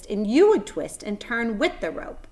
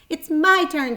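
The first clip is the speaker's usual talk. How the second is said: higher, louder demand; eager girl; 9 semitones higher, 7 dB louder, slower than usual. A narrator's reading. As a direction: insist on her turn. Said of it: "said Fern".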